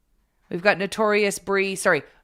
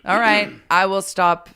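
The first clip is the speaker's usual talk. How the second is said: ordinary talk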